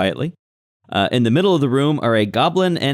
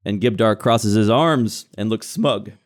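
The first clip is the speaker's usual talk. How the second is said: ordinary talk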